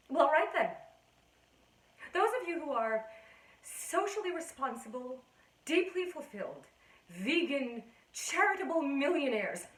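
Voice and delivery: loud, high-pitched sarcastic tirade; indignant challenge. Acted. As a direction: list them off loudly at your critics. Indignantly challenges those judging her.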